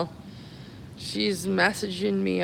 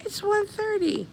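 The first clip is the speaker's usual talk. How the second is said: high-pitched disbelief, falling at the end; real surprise; 12 semitones higher than usual. Real, not acted. Streamer noticing how late it is, surprised. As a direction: jump way up in pitch, amazed at the hour.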